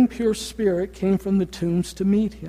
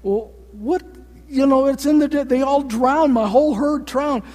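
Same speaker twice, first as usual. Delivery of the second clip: upset herdsman; 6 semitones higher than usual. Retold or imitated. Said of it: "a little upset going".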